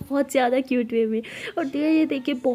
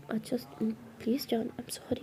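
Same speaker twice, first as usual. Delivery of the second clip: soft whispered plea, much quieter; hushed apology; 9 dB quieter than usual. Acted. Lily secretly apologising to her girlfriend, soft whisper. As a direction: whisper sorry so nobody hears.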